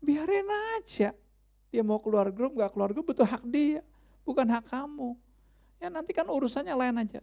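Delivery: inner voice. Retold or imitated. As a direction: let the voice inside needle you to let it go. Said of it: "ada suara ngomong".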